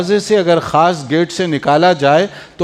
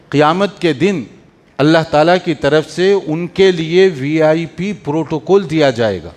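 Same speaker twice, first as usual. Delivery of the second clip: ordinary talk, nothing marked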